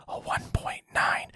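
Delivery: slow, drawn-out stage whisper; hushed mock whisper, word by word. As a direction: whisper it slowly into the mic, long gaps between phrases. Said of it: "whispering in the microphone".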